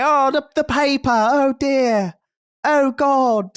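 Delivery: high-pitched Cockney villager out of a video game; mock Cockney. Podcaster recalling Fable's villagers, high mock Cockney accent. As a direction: squeal it in hammed-up Cockney. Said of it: "And then Cockney is, like, Fable".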